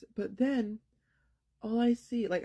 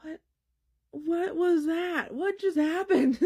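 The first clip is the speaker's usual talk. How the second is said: baffled disbelief; 10 semitones higher, 6 dB louder than usual. Retold or imitated. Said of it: "I'm like".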